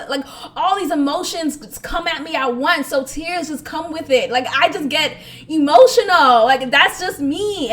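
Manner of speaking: normal talking voice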